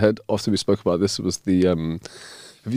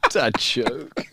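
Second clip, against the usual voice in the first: ordinary talk, nothing marked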